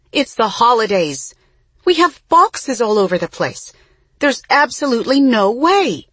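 high, loud and insistent; upset. A narrator's reading. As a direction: refuse it flatly, raising your voice. Mama refusing landlord on phone.

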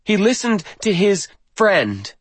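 put-on high-pitched pointed young woman; bitter accusation. A narrator's reading. Granddaughter blames grandfather's friend from pulpit, bitter. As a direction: aim it at the man you blame, pitched high. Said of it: "her bitterness apparently unchecked".

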